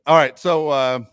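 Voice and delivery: ordinary delivery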